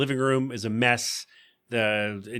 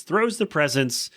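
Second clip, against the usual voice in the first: ordinary talk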